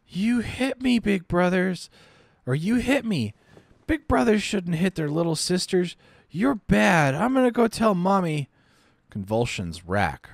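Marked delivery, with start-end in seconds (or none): 0.1-8.5 put-on child scolding her big brother, higher and petulant; high sulky little-girl voice. Little sister accusing her big brother, high sulky child voice. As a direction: whine like a hurt little sister.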